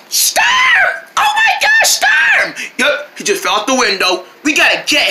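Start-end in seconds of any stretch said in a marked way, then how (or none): 0.1-2.5 panicked shout. Acted. Panicked calling for the dog.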